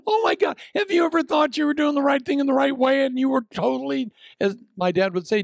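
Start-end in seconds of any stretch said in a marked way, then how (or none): none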